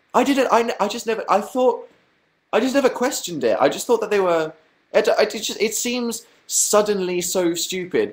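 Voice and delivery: high-pitched, stumbling protest; flustered self-defence. Real, not acted. Streamer defending himself to mocking chat, high and flustered.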